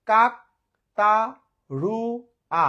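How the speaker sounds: কাকতাড়ুয়া split as "kak - ta - ru - a"; four syllables, each alone with a pause. Shown as a demonstration. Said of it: "চারবারে উচ্চারণ করলাম". (কাকতাড়ুয়া চার ভাগে, থেমে থেমে)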